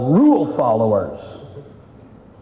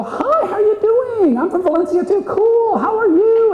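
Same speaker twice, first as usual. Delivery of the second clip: bubbly chatty caller. Retold or imitated. Coach imitating a chatty client calling, high and gushing. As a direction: play the overeager people-pleaser on the phone. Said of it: "I's are gonna call you and go".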